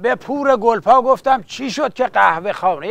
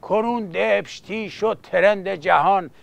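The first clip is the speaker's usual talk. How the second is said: softer rhythmic verse recital; epic teahouse storyteller; 3 semitones lower, 4 dB quieter than usual. Acted. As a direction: recite the bard's tale quietly.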